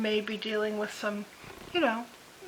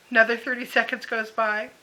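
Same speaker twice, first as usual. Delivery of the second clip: ordinary talk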